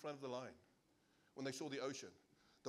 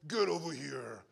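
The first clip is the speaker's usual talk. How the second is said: Mortal Kombat line; 8 dB louder, slower than usual. Retold or imitated. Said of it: "like Mortal Kombat".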